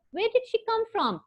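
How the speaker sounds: high, lightly voiced doctor's question; curious question. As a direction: read the question high with a lifted voice. Doctor asks, reading the quote.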